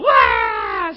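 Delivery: very high, loud hammed-up child's wail; a big fake bawling wah; a daughter wailing upstairs; mock wail. Child cries again.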